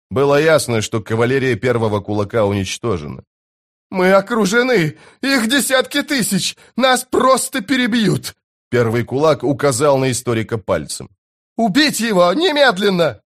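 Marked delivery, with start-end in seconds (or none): 3.9-8.3 very high-pitched wail of alarm; panicked cry. A narrator's reading. Commander panics on being surrounded. 11.6-13.2 high-pitched, hysterical command; frantic order. A narrator's reading.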